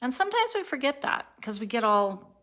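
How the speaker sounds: ordinary talk, nothing marked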